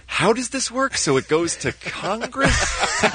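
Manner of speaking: high-pitched puzzled questioning; mock bewilderment. Acting out cast puzzling over plot.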